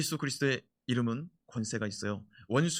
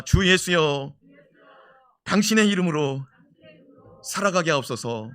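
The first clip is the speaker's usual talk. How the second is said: louder, slow phrases with pauses; loud prayer declaration; 3 semitones higher, 8 dB louder than usual. Shown as a demonstration. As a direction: cry it out as a prayer. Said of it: "선포합시다". (큰 소리로 기도 선포)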